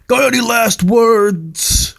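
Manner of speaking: high, slow hammed-up yell; imitating a monster screaming a taunt; mock scream. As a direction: bark the taunt right in his face. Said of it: "scream in his face".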